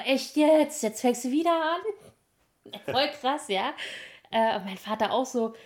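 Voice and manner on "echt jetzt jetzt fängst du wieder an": high, loud, exasperated mom; exasperated mother voice. Retold or imitated. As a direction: scold like an irritated mother.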